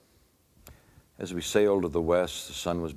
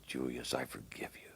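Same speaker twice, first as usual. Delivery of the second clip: "Julius, I forgive you" breathed as a full whisper; unvoiced loving whisper into the ear; tender whisper; 4 semitones lower, 11 dB quieter than usual. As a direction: whisper it as if holding him in your arms. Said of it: "Just as if Jesus was holding".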